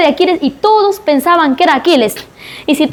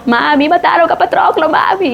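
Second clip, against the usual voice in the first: put-on whiny, tearful child's voice, a bit higher; mock crying; 3 semitones higher than usual. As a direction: play a big hero blubbering to his mum. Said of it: "Y lloró".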